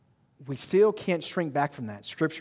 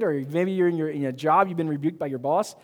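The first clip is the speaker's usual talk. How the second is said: ordinary talk, nothing marked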